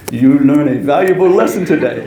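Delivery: imitating a French-accented driver's teasing. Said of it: "in his French accent".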